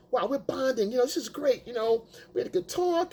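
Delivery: mock happy guy. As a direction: play the clueless guy pleased with himself.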